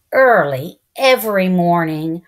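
usual speaking voice

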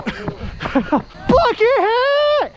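drawn out laughing call. Real, not acted. Laughing at nickname.

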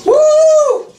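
high whooping cheer; playful high-pitched woo; mock woo. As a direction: whoop it up with the crowd. Mock cheer after crowd woo.

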